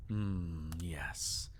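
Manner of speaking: soft, low whispered approval. Acted. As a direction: murmur it under your breath. Intimate approval, close up.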